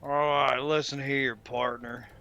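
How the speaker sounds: hammed-up Western cowboy voice; fake cowboy accent. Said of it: "That was my best, uh, [name] impression".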